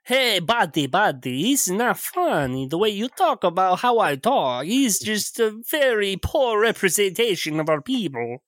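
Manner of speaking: offended mock Russian accent; Eastern European accent. Game master answering the mockery in a Russian accent. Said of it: "Terrible Eastern European cliches".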